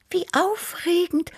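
high-pitched purring little cat; squeaky high voice of an admiring cat; Tibi. A narrator's reading. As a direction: purr it very high, full of wonder. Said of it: "schnurrte Tibi".

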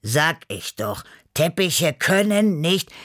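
slow, smug I-told-you-so; drawn-out gloating; triumphant. A narrator's reading. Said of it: "Beppo triumphierte".

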